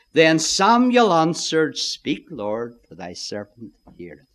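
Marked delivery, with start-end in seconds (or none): 1.8-4.3 hushed humble reply. Acted. Samuel's humble answer to God.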